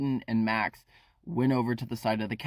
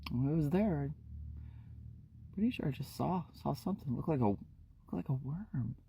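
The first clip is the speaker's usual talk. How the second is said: eerie hushed Shelly; 3 semitones higher, 9 dB quieter, faster than usual. Acted.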